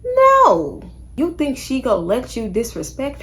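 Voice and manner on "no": a sudden high, loud no; emphatic no. Real, not acted. Creator telling men off with a sharp, high, loud no. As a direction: slam the door on it. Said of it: "emphatic answer to own question".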